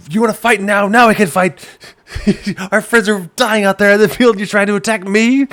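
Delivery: high, loud angry scream. Retold or imitated. Knight screaming at his friend mid-battle. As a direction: scream at him, almost laughing. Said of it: "I'm just screaming at him".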